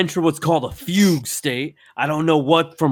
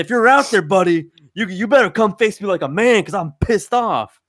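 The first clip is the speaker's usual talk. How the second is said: calling out; 7 semitones higher than usual. Retold or imitated. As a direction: call out into the dark like a tough guy. Calling out to intruder.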